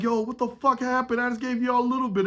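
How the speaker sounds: shocked friend demanding to know what happened; alarmed surprise. Retold or imitated. Finds drunk friend causing commotion.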